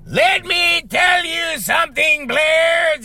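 high, slow, far-off shout like a wrestling heel. Retold or imitated. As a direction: bellow it like a ranting wrestler at the camera. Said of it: "Torquemada was like".

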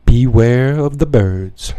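slow, drawn-out mock omen; mock-ominous warning. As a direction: stretch it like a spooky warning. Spooky sign-off warning to listeners.

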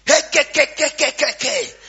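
loud, high mock tongues babble; mock tongues shouting. Uncle bursts in babbling loud in tongues. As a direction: ham up a shouting prophet.

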